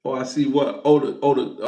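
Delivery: usual speaking voice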